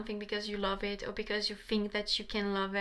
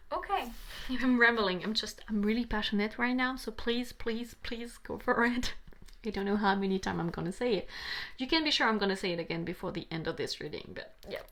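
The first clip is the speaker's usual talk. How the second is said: usual speaking voice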